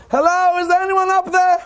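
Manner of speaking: high, drawn call up into the sky; desperate calling out. Retold or imitated. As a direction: call out for help to someone far above. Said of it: "out of desperation, he looks up".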